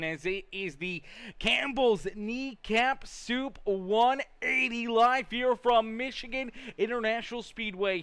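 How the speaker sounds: ordinary talk, nothing marked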